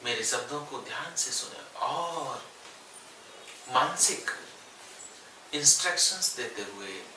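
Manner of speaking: unhurried yoga nidra guidance with long pauses; guided meditation. Real, not acted. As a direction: guide them gently into stillness, phrase by phrase. Leads yoga nidra body relaxation. (ठहर-ठहर कर योग निद्रा का निर्देश)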